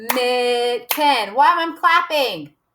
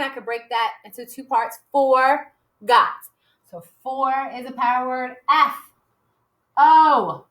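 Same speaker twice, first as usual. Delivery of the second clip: ordinary talk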